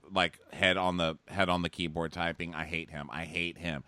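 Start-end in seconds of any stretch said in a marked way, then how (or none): 2.5-3.8 low, soft, defeated mutter; muttered loathing. Retold or imitated. Recapper typing about the suitor, quiet exasperated loathing. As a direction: mutter it low, as if slumped on the keyboard. Head on keyboard, typing in despair.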